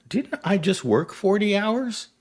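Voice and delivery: high, slow, disbelieving question; incredulous self-question. Retold or imitated. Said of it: "you're like".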